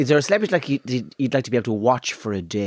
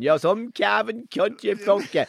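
slow, high-pitched Cavan accent; comic Cavan imitation; 7 semitones higher, slower than usual. Shown as a demonstration. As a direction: drag out the insult in thick Cavan. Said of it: "that's Cavan".

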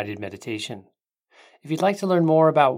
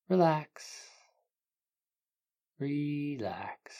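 guided meditation; 3 dB quieter than usual. Acted. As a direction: ease them into calm, unhurried.

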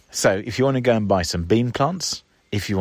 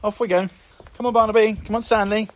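calling the dogs; 12 semitones higher than usual. Real, not acted. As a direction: coax the dogs along in a high pet voice.

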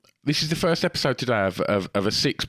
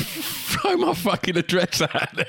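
laughing outrage; 10 semitones higher than usual. Real, not acted. Mock outrage at doxing.

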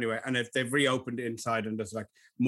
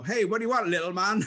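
higher-pitched mock barman, amused; barman's greeting; 6 semitones higher than usual. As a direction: lightly act out a chummy barman. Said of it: "short and go".

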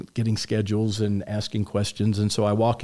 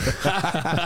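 short laugh; 7 semitones higher than usual. Real, not acted. Laughs at manager's benching plan.

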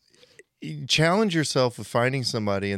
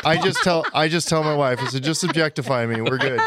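joking; 5 semitones higher, 7 dB louder than usual. Real, not acted. Joking self-mockery, laughing.

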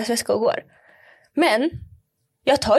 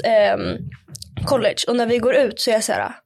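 ordinary delivery

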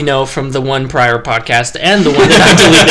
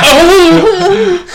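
surprised gasp; 14 semitones higher, 4 dB louder than usual. Retold or imitated. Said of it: "I'm like".

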